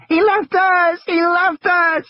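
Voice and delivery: Lex screams after Ed Regis ran away; screaming child. Acted. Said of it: "she had started to scream".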